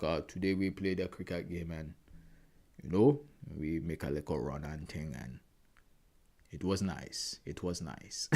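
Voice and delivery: low, soft, slow deadpan voice; father-in-law impression. Imitating his stoic father-in-law reporting a cricket game, low and slow. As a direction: play it stoic and deep, unhurried. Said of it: "My father-in-law, that's how he talks".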